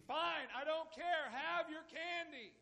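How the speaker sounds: high, slow, exasperated surrender; giving in. Acted. Father to nagging son, caving in exasperated. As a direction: throw up your hands and give in. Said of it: "just in desperation, give in and say".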